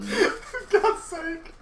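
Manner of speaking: high, slow words dissolving into laughter; can barely get it out for laughing. Real, not acted. Player groaning at a teammate's blunder, wheezing laughter.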